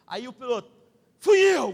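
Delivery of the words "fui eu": loud, high confession; booming pilot reply. Retold or imitated. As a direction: act the captain owning up boldly. Pilot owns up proudly.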